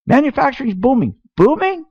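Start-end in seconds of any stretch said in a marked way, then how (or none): 1.4-1.9 incredulous. Real, not acted. Mocking disbelief at claim.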